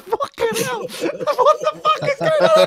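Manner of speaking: high, laughing squeal of disbelief; laughing mock outrage. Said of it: "I was just like".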